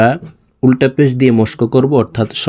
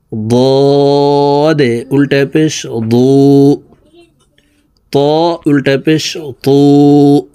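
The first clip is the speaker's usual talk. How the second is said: slow sing-song spelling chant; patient lesson chant, lines drawn out; drill recitation; 3 dB louder, much slower than usual. Shown as a demonstration. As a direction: recite each letter for the class to echo. Teacher drills letter recitation.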